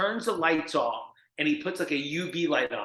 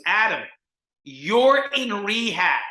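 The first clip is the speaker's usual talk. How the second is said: loud, slow, hammered-out words; exasperated counselor outburst; 3 semitones higher, 5 dB louder, slower than usual. Retold or imitated. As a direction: imitate a counselor slamming home reality. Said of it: "he starts slamming on the desk".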